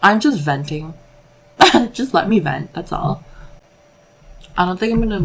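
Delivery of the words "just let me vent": laughing. Real, not acted. Laughs off venting.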